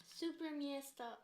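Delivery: slow, stretched Canadian vowels; exaggerated drawn-out Canadian accent; mock Canadian accent. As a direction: imitate a Canadian, adding a Y glide to the vowels. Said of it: "there were all these extra Ys".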